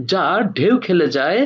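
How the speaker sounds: ordinary delivery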